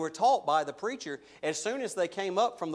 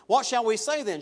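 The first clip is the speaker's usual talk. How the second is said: loud, mocking objector voice, higher; 5 semitones higher, 5 dB louder than usual. Preacher voicing the listener who'd sin for more grace, mocking and loud. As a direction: ham up the cheeky sinner's argument, half laughing. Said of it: "he anticipates them kind of saying this".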